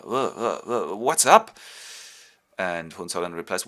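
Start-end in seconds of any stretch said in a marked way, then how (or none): 0.0-1.6 groggy stammer rising to a louder question; waking in confusion. Retold or imitated. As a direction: stammer awake, bewildered. Homburg wakes up confused.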